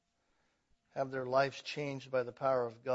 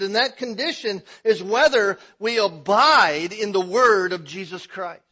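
loud, high, driving sermon climax; full-force preaching; 6 semitones higher, 17 dB louder than usual. Acted. Preacher pressing the church, loud and rising.

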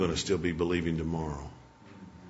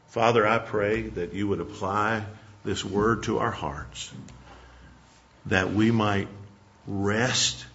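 earnest prayer swelling louder toward the end; closing prayer; 5 dB louder, slower than usual. Acted. Preacher closing the sermon in prayer to God, building in earnest. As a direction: pray over the congregation, building.

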